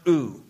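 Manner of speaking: "ou" said as one vowel, oo; Greek pronunciation. Shown as a demonstration. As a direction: say "ou" like a simple oo. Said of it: "It's just pronounced".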